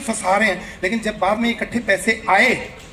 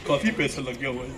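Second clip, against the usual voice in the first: lower, softer sulky mutter; grumbling rich guest; 5 semitones lower, 6 dB quieter than usual. Retold or imitated. Rich guest grumbles about the ticket money, low and sulky. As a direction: mumble like a miser.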